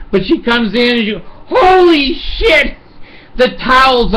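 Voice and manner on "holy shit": high, slow shocked cry. Retold or imitated. Wife walking in on the burning stove, shocked high cry. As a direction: drag it out in disbelief.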